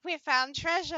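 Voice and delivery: high squeaky joy at found loot; squealing droid cheer. Pretend. Little droid squealing over found treasure with glee. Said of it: "R two is like".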